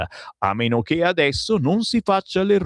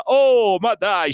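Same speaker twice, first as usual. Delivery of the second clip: mock exclamation; 9 semitones higher, 3 dB louder, much slower than usual. Mocking stuck drivers in traffic.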